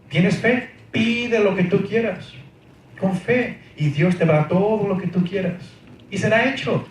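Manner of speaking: high-pitched mock preacher urging belief; imitating faith preachers. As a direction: stretch "pide" as if promising everything. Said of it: "Mucha gente hace énfasis en esto". (imitando a un predicador de la fe, en tono agudo)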